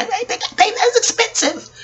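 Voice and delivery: high, laughing exclamation; complaining about school debt, laughing high; laughing disbelief. Real, not acted. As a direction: laugh at how absurd the price is. Laughing at tuition cost.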